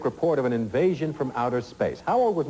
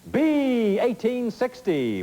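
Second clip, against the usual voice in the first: dramatic reveal; 11 semitones higher, much slower than usual. Real, not acted. Game show host unveils the winning answer to contestants, drawn out and suspenseful.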